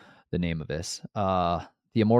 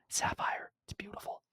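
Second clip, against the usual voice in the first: whisper; 13 semitones higher, 12 dB quieter than usual. Real, not acted. Whispered aside on sapphire.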